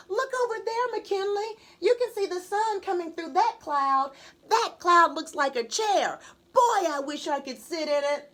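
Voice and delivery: a first grader wondering at clouds; dreamy little girl. Acted. Kendall voiced as a little girl.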